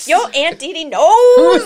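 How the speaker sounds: loud, high, drawn-out taunt; gloating mock yell, stretching the words; imagined trash talk. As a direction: crow it at a beaten opponent. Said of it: "I said all that other stuff on the inside".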